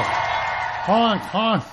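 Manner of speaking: playful car horn honks; honking. Pretend. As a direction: honk like a car horn, high. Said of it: "I love the honking".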